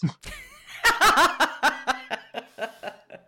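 loud, high burst of laughter; real laugh. Real, not acted. Storyteller's reveal of Cameron Diaz lands, big laugh. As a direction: crack up in a long laugh.

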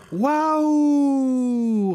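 long high wow; amazed wow. Acted. Children amazed by the champion mare, long high wow. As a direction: marvel at the horse. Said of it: "répondent en chœur les enfants".

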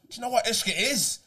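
high-pitched Irish lilt on the question; imitated Irish lad. Irish lad quizzing his mate in the chipper, mock high Irish lilt. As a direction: play an eager Irish lad posing a riddle. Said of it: "he goes".

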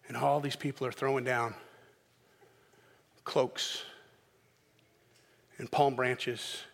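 ordinary talk

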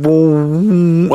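long, rising stomach gurgle noise; stretched "bwooo" sound effect; imitated stomach rumble. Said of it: "I can hear it in my stomach".

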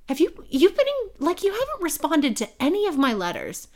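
high, stumbling protest; retold indignant complaint. Woman asking why he never answered her letters, indignant.

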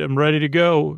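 ordinary delivery

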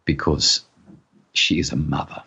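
hint of a stern sergeant, softened; sergeant's quiet conviction. A narrator's reading. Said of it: "said the sergeant".

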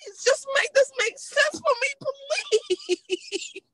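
high, slow, whining plea; drawn-out wail fading on the last please; mock-tearful pleading. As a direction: beg in hammed-up despair, half laughing. Begs for explanation, mock despair.

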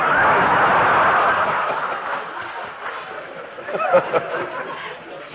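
a long, breathless real laugh at his own anecdote; hearty laugh. Real, not acted. Laughing at the fan who forgot his name, hearty laugh. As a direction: let the laugh roll out and fade.

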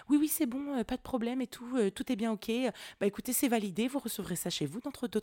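employée aimable. Retold or imitated. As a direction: reassure the caller as a now-helpful clerk. Imite l'employée devenue aimable. (employée serviable imitée, voix plus haute)

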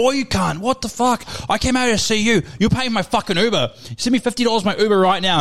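imitating an irate mate, high-pitched; mock screaming. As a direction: rant down the phone as your furious friend. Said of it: "he's screaming at me on the phone".

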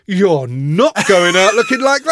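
loud, high forbidding outburst; stern dad. Acted. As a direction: lay down the law to your kid. Father forbidding son, acted.

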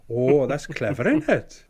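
dad marvelling at the key card; dad impression. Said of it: "he's like".

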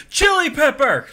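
high loud exclaimed pun; excited shout. Pretend. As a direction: blurt it out like a game-show answer. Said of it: "I'll come up with something like".

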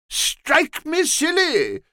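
high, slow, sozzled rogue's voice; a fuddled lout exclaiming slowly; drunken low fellow. A narrator's reading. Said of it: "a low fellow who was fuddled".

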